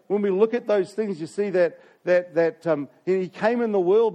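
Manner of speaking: rising sermon fervour; impassioned preaching. Acted. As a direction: proclaim it to the congregation. Preaching on the Gospel text.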